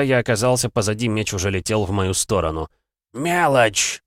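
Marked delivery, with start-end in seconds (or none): 3.1-4.0 злобно прорычать; с презрением рявкнуть; рычание. Acted. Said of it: "рычал мой противник". (злобный рык)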